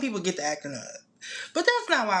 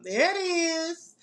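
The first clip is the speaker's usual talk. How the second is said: pleased surprise; 9 semitones higher, 3 dB louder, slower than usual. Real, not acted. Spotting the heart appear.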